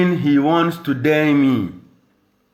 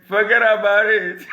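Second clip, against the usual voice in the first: laughing; 8 semitones higher than usual. Real, not acted. Laughing off his own threat.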